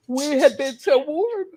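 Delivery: words stretched out through a yawn; talking through a big real yawn. Real, not acted. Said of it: "I'm yawning".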